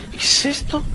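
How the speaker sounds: susurrado, con asombro; susurro incrédulo. Retold or imitated. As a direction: soltarlo en un susurro desconcertado. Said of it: "Al minuto de empezarlo a oír". (susurro de asombro al oír el disco)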